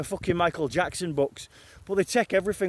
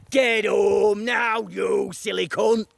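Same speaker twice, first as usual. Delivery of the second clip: slow, drawn-out scolding in a put-on mum voice; mum on the phone; slower than usual. Mum on the phone ordering her son home, slow menacing scold. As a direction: bark it slowly down the line like a furious mother. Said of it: "my mum ringing me".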